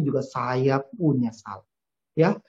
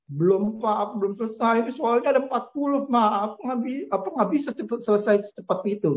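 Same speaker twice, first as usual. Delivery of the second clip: put-on anxious child; 6 semitones higher than usual. Frightened son answers his father.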